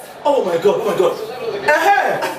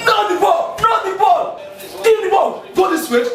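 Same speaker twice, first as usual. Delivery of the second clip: yelling instructions; 8 semitones higher than usual. Real, not acted. Husband coaching players through the TV.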